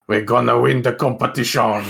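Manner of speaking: put-on heavy Mexican accent, wrestling announcer; lightly done thick Mexican accent. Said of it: "heavy Mexican accent".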